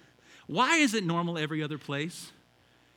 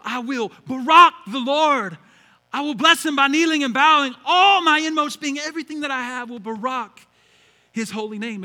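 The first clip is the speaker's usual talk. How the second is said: preaching at full force; 6 semitones higher, 10 dB louder than usual. Acted. Pastor reading the psalm to the congregation, full preaching voice. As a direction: proclaim the psalm with power.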